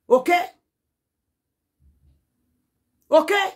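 high, loud rising okay?; sharp rising challenge; loud challenging okay. Real, not acted. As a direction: demand an answer from the viewers. Confrontational rhetorical question to viewers.

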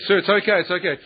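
high, urgent airline man begging him; mock-flustered, plead with him to calm down; retold panicky plea. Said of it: "He freaked out and he ran back".

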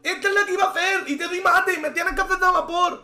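very high, loud yelling; frantic shouting. Real, not acted. Streamer yelling that he misplayed his intended move, frantic shouting. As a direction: shout it all out in a frenzy.

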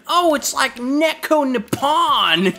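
slow, high, put-on gushing voice; mocking anime fan. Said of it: "hear her say all her cringy lines".

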